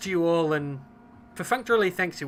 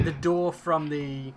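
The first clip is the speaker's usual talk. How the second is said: ordinary delivery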